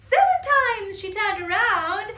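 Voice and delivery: usual speaking voice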